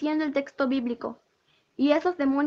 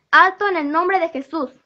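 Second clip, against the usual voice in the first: commanding shout; 3 semitones higher, 7 dB louder than usual. Retold or imitated. Dreamer commands demons' darts to stop, loud shout. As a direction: bark the order with full authority.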